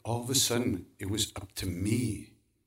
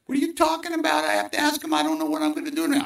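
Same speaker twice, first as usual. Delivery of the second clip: much higher, louder anxious protest; panicky inner voice; 6 dB louder, faster than usual. Acted. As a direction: squeal it out in a panic. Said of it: "the other part of me says".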